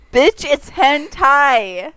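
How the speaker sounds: loud. Real, not acted. Loud correction of friend.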